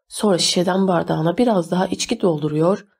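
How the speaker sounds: plain reading voice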